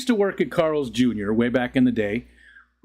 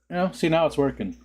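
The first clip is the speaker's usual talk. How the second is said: ordinary talk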